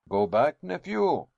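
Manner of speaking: loud, sharp alarmed outburst; alarmed cry. A narrator's reading. Uncle to his nephew, an alarmed loud cry. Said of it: "cried my uncle in a tone of alarm".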